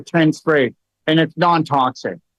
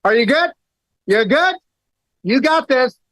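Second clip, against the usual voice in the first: put-on higher, light and upbeat, cheering the kid on; calm reassurance to a hurt kid; 9 semitones higher than usual. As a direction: keep it breezy so the child stays calm. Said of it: "I'd go".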